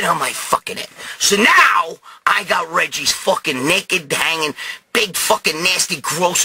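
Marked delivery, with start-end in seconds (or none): none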